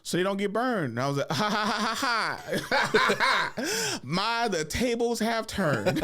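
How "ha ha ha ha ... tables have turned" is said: high, slow, hammed-up gloat; mock gloating laugh. Kid gloating at sunburned cousins, hammed-up laughing gloat. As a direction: cackle mockingly.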